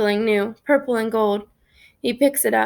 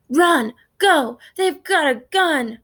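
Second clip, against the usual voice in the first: louder and higher, frantic warning; panicked shout; 5 semitones higher, 3 dB louder, slower than usual. A narrator's reading. Gang member to the crew, shouting a frantic warning. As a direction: yell it so the whole gang bolts. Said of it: "Bones shouts".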